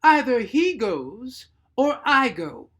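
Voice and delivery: slow, high and loud ultimatum; firm as a rock. Acted. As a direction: lay down the ultimatum, unbending. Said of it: "firm as a rock".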